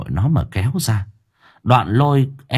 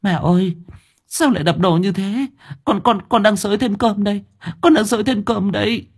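high, panicky stammering answer; flustered mother; 11 semitones higher than usual. A narrator's reading. Mother calling back from the kitchen, flustered. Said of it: "đáp lên có phần hoảng hốt".

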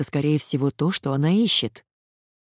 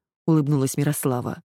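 ordinary delivery

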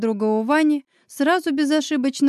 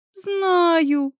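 high, drawn-out whine; plaintive, stretched moan; mock wail; 7 semitones higher than usual. A narrator's reading. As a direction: wail it like a weepy friend. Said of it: "провыла Оля".

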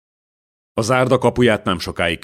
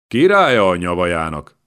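spat out scornfully; 6 dB louder than usual. A narrator's reading. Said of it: "köpött ki bátyja".

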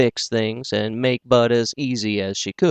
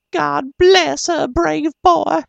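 very high, slow, voice near tears yet smiling; smiling and crying; 5 dB louder, slower than usual. A narrator's reading. Mother blessing her wounded son, smiling and crying. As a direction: laugh and weep through the blessing. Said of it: "smiling and crying both to once".

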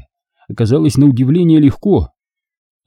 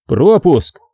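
short, higher-pitched demand; curt demand; 6 semitones higher than usual. A narrator's reading. Guard demanding a pass at the console, curt. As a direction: toss out one curt word. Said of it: "коротко бросил он".

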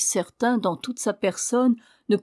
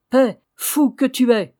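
louder sharp cut-off, higher; angry interruption; 3 semitones higher, 6 dB louder than usual. A narrator's reading. Lord cuts off the albino, sharp and loud. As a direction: silence the fool mid-sentence. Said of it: "voulut interrompre".

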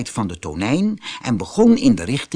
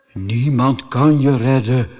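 ordinary delivery

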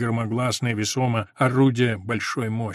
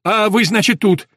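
higher, louder, mimicking retort; mocking echo; 8 semitones higher, 8 dB louder than usual. A narrator's reading. Messenger mockingly echoing the sergeant, loud. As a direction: throw his words back at him. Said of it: "не удостоил его взглядом".

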